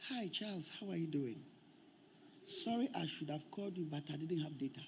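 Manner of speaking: put-on soft, understated girlfriend's call; girlfriend on the phone. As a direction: quietly act out a girlfriend apologising. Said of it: "That's how she talks".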